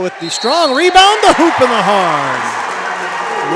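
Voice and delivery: rising shout at the buzzer, then a long drawn-out finish; excited call. Real, not acted. Play-by-play man calling the buzzer putback, excited shout. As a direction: belt it out as the quarter ends.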